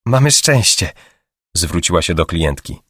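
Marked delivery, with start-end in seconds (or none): 0.0-0.9 higher-pitched happy exclamation; pleased excitement. A narrator's reading. Matylda turning to her client, pleased and excited. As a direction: share your delight at the good luck.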